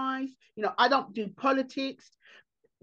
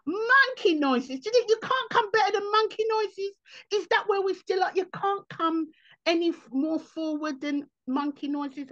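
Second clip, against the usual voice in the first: mock scolding; 8 semitones higher than usual. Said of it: "I said".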